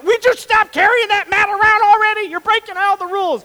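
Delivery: very high, loud mock outrage; hammed-up high-pitched scolding yell; exasperated yelling. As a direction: shriek the rules at him. Pharisees scolding, acted outburst.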